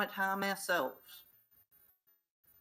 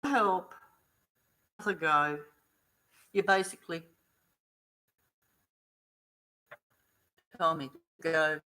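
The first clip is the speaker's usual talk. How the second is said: indignant complaint; 2 semitones higher, 4 dB louder than usual. Retold or imitated. Telling off the staff.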